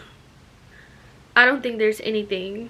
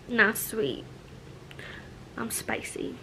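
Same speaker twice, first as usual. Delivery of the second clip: sassy whisper; 8 dB quieter than usual. Real, not acted. Sassy self-description, whispered.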